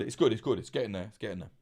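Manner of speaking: ordinary talk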